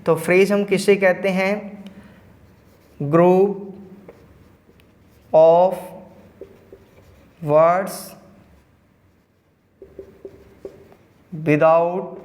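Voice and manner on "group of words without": word by word, with long pauses; slow dictation. Real, not acted. Teacher giving students the definition of phrase, slow dictation. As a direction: dictate slowly, leaving big gaps between words.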